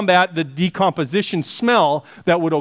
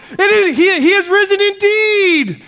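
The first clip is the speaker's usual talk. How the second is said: shouted response; 13 semitones higher, 6 dB louder than usual. Retold or imitated. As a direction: imitate an exultant churchgoer shouting the response back. Answering the even louder greeting.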